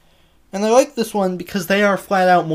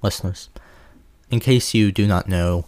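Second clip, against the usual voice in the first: low announcer voice; 7 semitones lower than usual. Acted. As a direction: drop the pitch and address the listeners. Addresses listeners.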